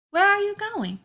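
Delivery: put-on high, loud, bright-eyed kid asking where a friend goes. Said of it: "You probably said".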